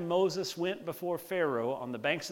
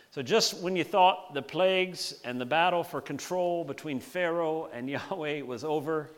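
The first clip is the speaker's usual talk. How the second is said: ordinary talk, nothing marked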